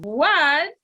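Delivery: ordinary talk, nothing marked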